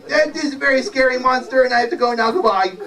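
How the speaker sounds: high, hurried wizard voice; fleeing wizard. Acted. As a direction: hurry through it and take your leave. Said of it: "And the wizard said".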